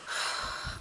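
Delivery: long breathy yawn; big yawn. Real, not acted. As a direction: let out a tired yawn. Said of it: "I'm yawning so much".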